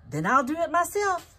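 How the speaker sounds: high, loud, determined hen voice; the hen declares she'll do it herself; mother hen. Acted. Hen's determined reply in story.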